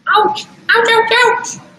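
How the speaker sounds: high piping ouch, ouch; squeaky mock ouches. Owner yelping at the puppy clawing her chest, high and playful.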